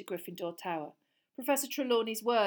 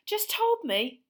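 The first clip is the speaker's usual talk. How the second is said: panting; 13 semitones higher, 9 dB louder, slower than usual. Acted. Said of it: "Harry panted".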